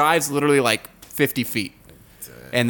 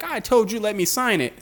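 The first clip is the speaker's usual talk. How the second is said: whiny complaint; 8 semitones higher than usual. Retold or imitated. Whiny cuffed driver.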